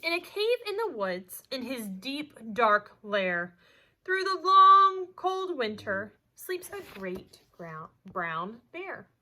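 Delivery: lively storybook voice, big swings of pitch and loudness; animated read-aloud; reading to children. A narrator's reading. Teacher reads picture book aloud.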